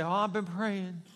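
slow, fretful sigh of a prayer; worried tone. Shown as a demonstration. Pastor mimicking a worried prayer, slow and fretful. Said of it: "even in the tone of voice, you can tell".

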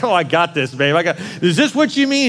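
a pleased husband showing off his counseling trick, laughing; cocky. Retold or imitated. Husband to wife, smug and laughing. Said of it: "I thought I had this".

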